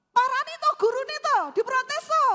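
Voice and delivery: high, loud outburst of advice; worked-up, emotional. Acted. Husband jumping in after wife's story, worked-up. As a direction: play a hot-headed husband urging a confrontation. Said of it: "ojo emosi".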